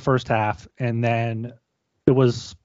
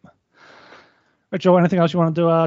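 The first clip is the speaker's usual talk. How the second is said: ordinary talk, nothing marked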